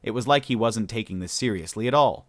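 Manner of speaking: ordinary delivery, nothing marked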